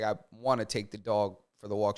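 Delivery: low, quiet, understated husband voice; imitated Toronto husband. Husband saying a line on wife's request, understated imitation. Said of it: "This is what he sounds like".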